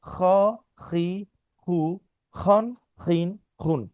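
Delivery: "খ" said from the back of the throat with each vowel, slowly; repeated letter drill. Shown as a demonstration. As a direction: model "খি", "খু", "খুন" for the class to repeat. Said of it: "আবার বলছি". (কণ্ঠনালী থেকে "খ" উচ্চারণের অনুশীলন)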